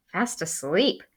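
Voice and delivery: usual speaking voice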